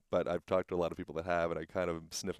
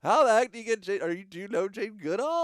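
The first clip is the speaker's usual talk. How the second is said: much higher, louder thrilled squeal; excited gush; 12 dB louder than usual. Real, not acted. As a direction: gush with delight at a famous name. Amazed at the famous endorsement.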